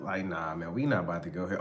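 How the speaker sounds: low, soft, quick brush-off; dismissive. Acted. Partner ducking questions about his phone, low and dismissive. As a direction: back out of it under your voice. Acted partner backing out of quarrel.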